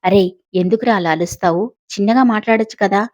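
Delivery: usual speaking voice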